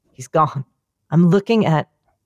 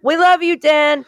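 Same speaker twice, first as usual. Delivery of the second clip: mock crowd cheer; 10 semitones higher than usual. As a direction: shout it like a whole crowd. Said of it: "Say it, remember?".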